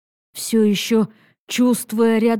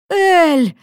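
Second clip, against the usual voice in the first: louder, high, gasping call of Эль; breathless cry; 5 semitones higher, 5 dB louder than usual. A narrator's reading. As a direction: gasp her name out in relief. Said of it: "выдохнул он".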